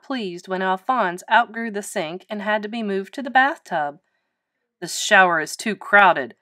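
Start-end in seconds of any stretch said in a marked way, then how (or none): 4.8-6.4 loud, lightly grumbling father; mildly put-out grumble; complaining. A narrator's reading. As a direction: complain with weight on each word. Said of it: "complained Lewis's father".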